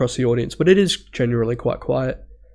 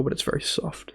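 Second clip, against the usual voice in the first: ordinary talk, nothing marked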